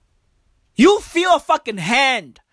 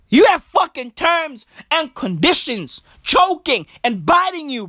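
ordinary delivery

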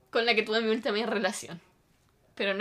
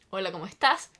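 lower, easy hello; polite greeting; 4 semitones lower than usual. Shown as a demonstration. Showing how her ex should have approached, polite and calm. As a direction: greet gently, with no surprise. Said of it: "como, por favor, dime".

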